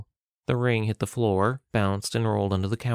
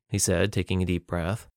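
ordinary delivery, nothing marked

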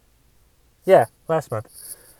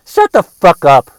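louder sudden snap; angry snap; 6 dB louder than usual. Acted. Comic cutting off his own whine, angry. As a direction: bark it to cut yourself off. Snapping at himself.

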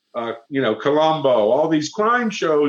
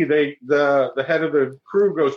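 normal talking voice

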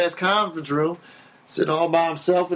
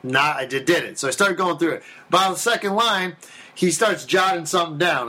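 ordinary delivery

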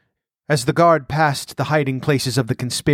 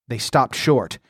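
usual speaking voice

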